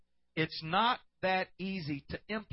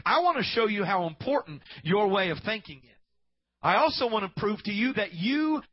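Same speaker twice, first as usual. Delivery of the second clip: loud, driving sermon delivery; full-force preaching; 3 semitones higher, 6 dB louder than usual. Acted. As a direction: preach it hard to the congregation.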